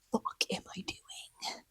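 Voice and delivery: hushed despairing whisper. Acted. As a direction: mutter it to yourself under your breath.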